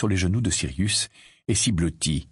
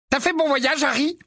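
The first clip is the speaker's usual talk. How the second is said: high, light friendly greeting; cheerful young man's hello; Bill's voice. Acted. Bill greeting Harry across the table, friendly.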